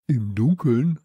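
loud, exclaimed question; called out. A narrator's reading. Samson is asked to go looking, calling out in question. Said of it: "rief Samson".

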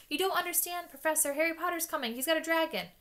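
a desperate schoolboy protesting; whiny Malfoy. Acted. Malfoy pleading with the professor, high and whiny.

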